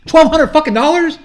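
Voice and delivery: loud, high-pitched indignant thought; exasperated inner rant. Retold or imitated. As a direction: blow up at the tiny sum. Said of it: "in my head I'm thinking".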